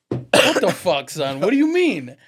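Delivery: loud, high-pitched disbelieving yell; shocked outburst. Retold or imitated. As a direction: demand an explanation in loud, acted shock. Retold shocked reaction to son's confession.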